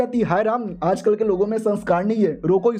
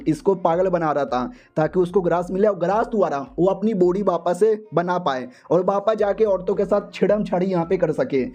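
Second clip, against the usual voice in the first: ordinary delivery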